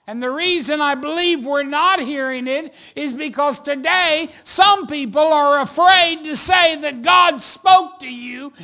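loud, high, driving sermon delivery; full-force preaching. Real, not acted. Pastor telling congregation God is speaking, impassioned preaching.

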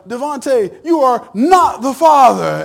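high, slow, loud announcement; mock host verdict. To Devontae, the host reading the result, slow and loud. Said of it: "they come back and say".

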